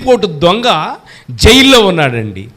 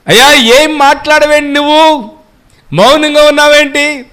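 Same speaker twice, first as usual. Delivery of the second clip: pleading call; 8 semitones higher, 5 dB louder than usual. Acted. Preacher voices pleading question.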